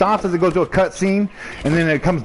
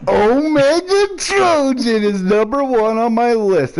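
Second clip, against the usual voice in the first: dramatic announcement; 6 semitones higher, slower than usual. Acted. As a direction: announce it like a verdict.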